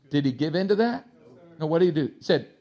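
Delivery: normal talking voice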